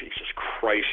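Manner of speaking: worn-out whisper over the mess; whispered exasperation. Real, not acted. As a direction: sigh it out without voice. Exasperated aside.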